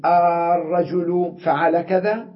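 long, loud "آه", then "الرجل فعل كذا" slow and rising; drawn-out "آه" that makes it a question; questioning madda. Shown as a demonstration. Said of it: "وإن كانت في الرجل أقول". (سؤال بمد "آه" وبطء)

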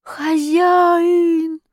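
high, long drawn-out cry; stretched high cat voice; cat's distant call. A narrator's reading. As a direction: wail for your master from afar. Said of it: "услышал я голос Кузи".

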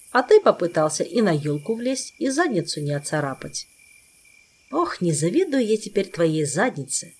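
ordinary delivery

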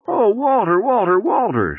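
very high, lamenting wail; keening. A narrator's reading. Shopping bag lady laments over Walter, keening.

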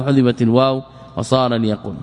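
usual speaking voice

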